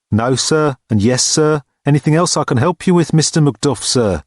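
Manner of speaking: college porter. A narrator's reading. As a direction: answer with unblinking, dutiful formality.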